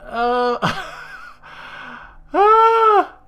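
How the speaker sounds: loud, high despairing cry; real loud despair; real frustration. Real, not acted. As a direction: cry out at the game. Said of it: "player frustrated at game".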